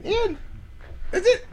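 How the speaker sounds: high fake sob; mock crying.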